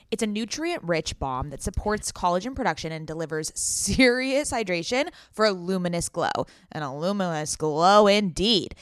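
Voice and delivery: upbeat sponsored ad read. Acted. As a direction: sell it like a podcast ad.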